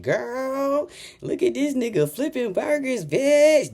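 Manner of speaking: high-pitched mock girlfriend gossip voice. Imitating critics gossiping about a burger job, mocking. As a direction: put on a high, catty voice. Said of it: "That's how you sound".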